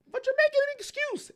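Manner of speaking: very high, quick mimicking voice; squeaked-up, hurried imitation of a critic; mimicked objector. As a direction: shoot way up high for the objector's line. Voices an imagined objector mid-rant.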